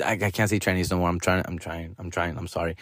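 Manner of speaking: sorry, sorry, backing off quietly; sheepish apology. Real, not acted. Host apologizing to listeners for a slur, soft and sheepish. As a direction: drop to a soft, sheepish mumble.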